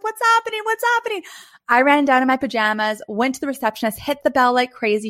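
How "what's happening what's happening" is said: high alarmed repeated question. Retold or imitated. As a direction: boyfriend on the phone panicking, high and urgent. Ex on phone alarmed.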